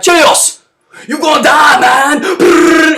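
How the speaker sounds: mock thug yell with gunfire noise; gangster threat. Gangsters yelling at Chelios, mock thug shout. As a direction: bark it like a movie gangster.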